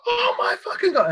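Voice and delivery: retold girl mortified in front of the line; mock outrage. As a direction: shriek of humiliated disbelief, imitated. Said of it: "And then she's just like".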